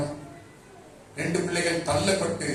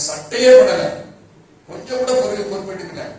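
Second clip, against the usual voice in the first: loud exclamation; 5 semitones higher, 11 dB louder than usual. Real, not acted. Preacher exclaims over the boy.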